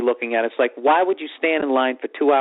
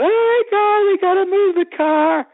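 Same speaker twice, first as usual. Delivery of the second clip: high, loud, stretched panicky shriek; imitated freak-out; 4 dB louder than usual. Girlfriend spots the parking ticket, hammed-up freaking out. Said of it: "So she starts freaking out".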